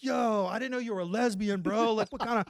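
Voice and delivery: mocking taunt. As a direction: tease him like a bro. Locker room roasting Pat.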